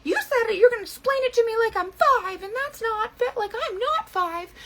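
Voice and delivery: high, whiny mock of an offended customer. Mocking an offended viewer's whiny complaint. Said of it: "They were like".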